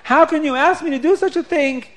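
high, tearful pleading question; almost crying. Acted. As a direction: close to tears, begging. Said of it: "he's almost crying".

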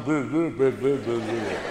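low nonsense babbling, imitating pompous wine tasters; mock wine taster babble. Comic mimicking wine tasters walking up and down, mock babble. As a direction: burble away like a silly connoisseur.

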